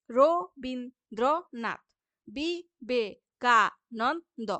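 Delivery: each example word drawn out slowly, syllables apart. Shown as a demonstration. Teacher sounding out example words syllable by syllable. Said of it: "ঠিক একইভাবে".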